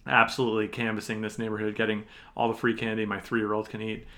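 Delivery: normal talking voice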